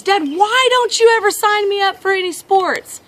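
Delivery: put-on high, pleading kid complaint; frustrated boy. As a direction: imitate an exasperated child appealing to Dad. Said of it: "clearly frustrated. And he says".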